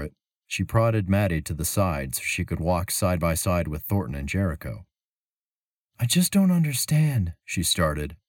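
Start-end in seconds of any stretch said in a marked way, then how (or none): 6.0-7.3 put-on high, lifted young woman's voice; Mira's voice. A narrator's reading. Traveler telling her companion she's puzzled, in a high worried voice. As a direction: voice Mira up high, puzzled and uneasy.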